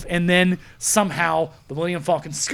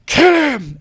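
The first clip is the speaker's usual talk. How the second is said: high, loud barked command; Kylo shouting an order; 10 semitones higher, 11 dB louder than usual. Retold or imitated. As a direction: yell the order at the troops. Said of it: "Kylo Ren going".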